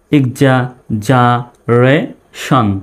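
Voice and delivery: "ইকজা, জা, রে, শন" in separate chunks with pauses; syllable by syllable. Shown as a demonstration. Teacher splitting exaggeration for learners, syllable by syllable. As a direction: leave a clear gap after each chunk of "ইকজা, জা, রে, শন". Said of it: "ভেঙে ভেঙে উচ্চারণ করার".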